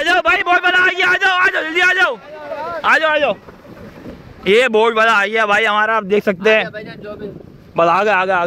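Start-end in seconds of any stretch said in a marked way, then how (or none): 0.0-3.4 calling out. Real, not acted. Calling the boat over.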